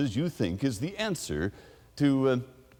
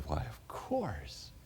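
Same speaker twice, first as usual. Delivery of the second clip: slow and much softer, drawn out; slow gentle reply; 8 dB quieter, much slower than usual. Retold or imitated. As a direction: answer slowly and quietly, taking time. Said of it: "and I said".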